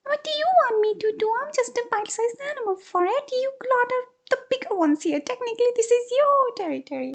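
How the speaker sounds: very high squeaky mongoose voice; squeaked. Acted. Mongoose squeaking at the couple, flustered. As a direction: squeak back, flustered and small. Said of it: "squeaked at them".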